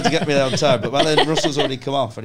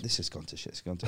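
muttered despair; 6 semitones lower, 13 dB quieter, faster than usual. Retold or imitated. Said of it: "I went".

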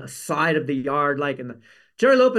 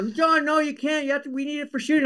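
pleading props guy; 9 semitones higher than usual. Retold or imitated. Props crew refuses last board.

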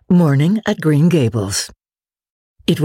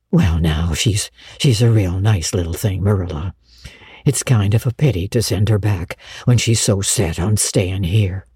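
low, mild-mannered man; hesitant Matthew figure; 6 semitones lower than usual. Acted. Matthew pleading with Marilla for the girl, hesitant. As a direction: plead gently in a low, halting voice.

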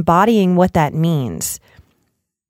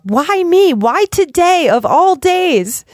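much higher, slow poor-me whine; a hammed-up wail of self-pity, pitched way up and drawn out; 9 semitones higher, 3 dB louder, slower than usual. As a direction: act out the childish why-me self-pity. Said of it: "immature self-pity that can come up".

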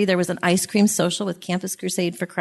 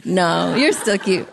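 laughing through a little emotion; a touch teary, laughing it off; laughing, choked up about their first meeting; emotional laugh. Real, not acted. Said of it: "I get emotional".

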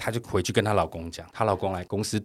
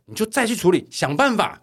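higher, pushy boss voice; boss's order; 9 semitones higher than usual. Retold or imitated. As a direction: imitate the boss nagging. 老板下令再去处理. (模仿老板催促)

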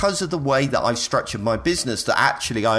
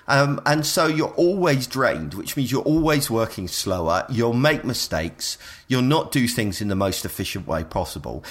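ordinary talk

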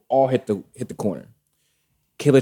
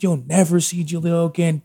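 high-pitched imitation of an angry threat; mock flipping out; 8 semitones higher than usual. Ex threatens the father of her son, mock fury. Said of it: "Flipping the fuck out".

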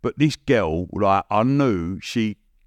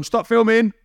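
high, loud mock shout; retold shout; 12 semitones higher, 4 dB louder than usual. Producers shouting to halt the cameras, loud and high. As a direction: bark the order to cut. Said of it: "They've gone".

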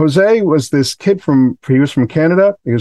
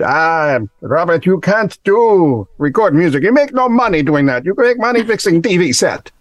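higher-pitched mock Russian accent; 5 semitones higher than usual. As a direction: imitate a shop teacher in a thick Russian accent. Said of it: "it is Russian".

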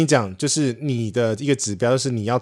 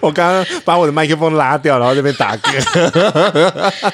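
laughing; 4 semitones higher, 5 dB louder than usual. Real, not acted. Recounting the burp, laughing.

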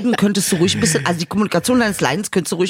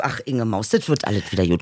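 mock comforting; 8 semitones lower, 5 dB quieter than usual. Comforting friend.

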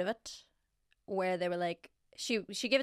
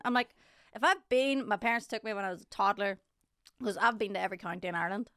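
ordinary talk, nothing marked

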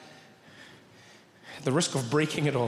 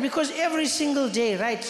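high-pitched, fed-up Indian schoolboy; Thiessen; 5 semitones higher than usual. Retold or imitated. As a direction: rant on and on as the exasperated friend. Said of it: "And he said".

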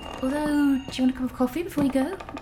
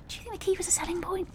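breathy whispered aside, quick; 5 semitones higher, 12 dB quieter, much faster than usual. Acted. Muttering to herself about the keeper, whispered. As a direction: hush it out fast, just to yourself.

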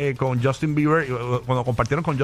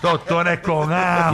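savoring slowly; slower than usual. Pretend. Savoring food names.

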